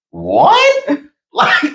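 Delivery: mock shock. Husband hearing strangers surprised he has fun with his wife, squeaking in mock shock. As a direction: play it as a disbelieving double take.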